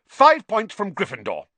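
officious terse verdict. A narrator's reading. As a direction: hand down the penalty curtly. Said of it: "Percy said tersely".

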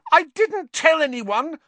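high, loud shriek. A narrator's reading. Hermione shrieks at Lupin, high and loud. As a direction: shriek it at him. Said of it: "Hermione shrieked".

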